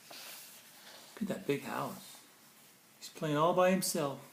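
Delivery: plain reading voice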